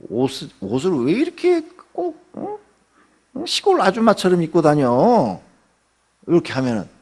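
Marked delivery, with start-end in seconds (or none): 0.1-5.4 higher, sneering nag about clothes; a busybody's scornful jab; mocking critic. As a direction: look down on her outfit. Acted critic mocks clothes.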